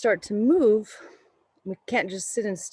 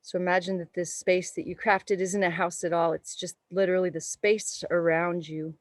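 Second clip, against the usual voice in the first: calm, unhurried meditation voice; guided meditation. Acted. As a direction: guide the breath gently. Leads a yoga meditation.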